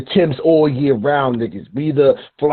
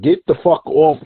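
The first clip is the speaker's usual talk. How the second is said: normal talking voice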